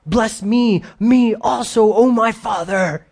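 a son weeping for a blessing; weeping plea. Acted. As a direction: cry out to the father, broken. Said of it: "And Esau lifted his voice and wept".

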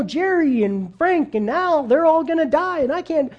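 a heartbroken inner cry, slowing down; anguished inner thought. Retold or imitated. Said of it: "He's probably thinking in his mind".

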